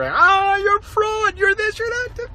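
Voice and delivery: high mocking voice parroting the critics; mocking whine. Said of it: "your little tiny notes to me".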